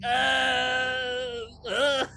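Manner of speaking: high hammed-up grunts; mock yell. Fulci attacked by crows, mock yell. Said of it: "he's like".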